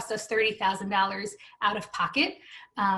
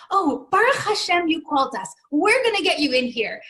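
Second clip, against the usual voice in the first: put-on high, louder doting old-lady voice; sweet old lady; 7 semitones higher, 6 dB louder than usual. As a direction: gush like a kind old secretary who just found out you're family. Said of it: "like, this sweet old lady".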